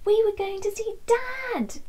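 high, bright burst of joy; thrilled shout of good news; excited. A narrator's reading. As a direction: cry it out with delight. Learning she will see Dad.

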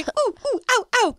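high ooh and ow yelps; mock pain yelps. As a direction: yelp like it hurts. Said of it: "I was going".

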